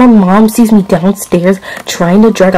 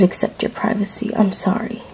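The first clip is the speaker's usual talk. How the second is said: whispered apology; 4 semitones lower, 11 dB quieter than usual. Acted. Mom apologizing softly to daughter.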